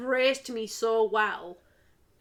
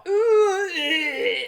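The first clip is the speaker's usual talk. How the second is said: high, loud Scottish wail; exaggerated Scottish accent; 7 semitones higher, 9 dB louder than usual. Showing Scottish is a lot more, loud exaggerated Scottish wail. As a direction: stretch the vowels into a mock Scottish howl. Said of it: "It's a lot more".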